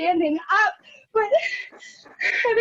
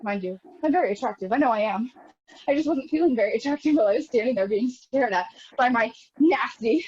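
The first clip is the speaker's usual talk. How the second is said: ordinary talk, nothing marked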